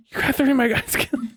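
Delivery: half-laughing high-pitched accusation; laughing mock complaint. An ally scolding over lost soldiers, high and laughing.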